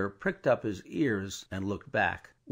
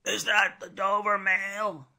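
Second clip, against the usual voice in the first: voice from the mist; 10 semitones higher, 3 dB louder than usual. A narrator's reading. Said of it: "a man's voice called from the mist".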